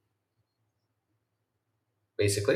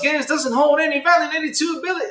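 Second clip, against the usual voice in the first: high read-aloud tone; chat reading voice; 11 semitones higher than usual. Real, not acted.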